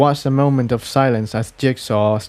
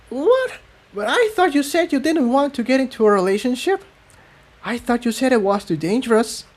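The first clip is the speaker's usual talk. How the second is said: high, fast, startled disbelief; surprised; 12 semitones higher than usual. A narrator's reading. Tiptoe stunned after Jigsaw kisses her, high surprised voice. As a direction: caught completely off guard. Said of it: "Tiptoe blinked in surprise".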